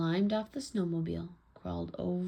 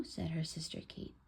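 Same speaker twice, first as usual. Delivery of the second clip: ordinary delivery, nothing marked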